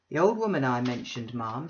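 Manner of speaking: a loud, bluff self-made man; blustering Bounderby. Acted. Bounderby booming an invitation to Mrs Sparsit. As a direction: boom the invitation like a braggart host. Bounderby cuts matter short.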